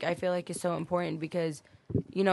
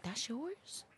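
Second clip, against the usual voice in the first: higher, softer doubtful question; nosy stranger; 6 semitones higher, 13 dB quieter than usual. Retold or imitated. Strangers at the store asking, higher and soft.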